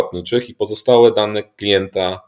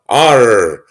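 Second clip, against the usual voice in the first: pirate voice; 5 dB louder than usual. Acted. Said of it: "Międzynarodowy Dzień Mówienia jak Pirat".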